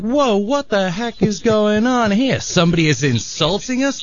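high-pitched, put-on outrage; mock indignation. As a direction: act affronted by a heckler. Acted indignant reaction in a joke.

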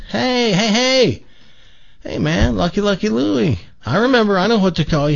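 high, excited acted hello; eager hailing. Man meets same guy again, calling out eagerly. Joke character hails buddy third time.

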